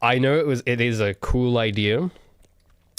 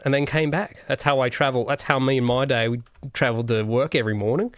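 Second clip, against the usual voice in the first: mock Australian bloke, pitched up; hammed-up Aussie; 3 semitones higher than usual. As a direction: put on an exaggerated Aussie twang. Plays up stereotype Aussie on admitting nationality.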